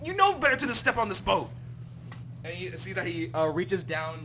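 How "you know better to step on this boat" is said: louder, higher stern telling-off; a dad losing patience; scolding father. Acted. Thompson scolding Frill for boarding the boat.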